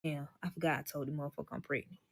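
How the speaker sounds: low, hushed aside to oneself; inner thought. Retold or imitated. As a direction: think it aloud, quick and under the voice. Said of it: "in the back of my head".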